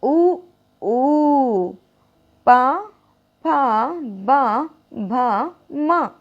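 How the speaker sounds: उ, ऊ, पा, फा, बा with the lips pressed; lip sounds demo. Shown as a demonstration. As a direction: press the lips for each syllable. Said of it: "इसको कीजिए".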